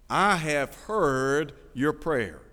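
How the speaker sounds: very slow, weighted delivery of God's answer; slow, solemn quote. Acted. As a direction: draw out each word as God's reply. Preacher voices God answering Hezekiah.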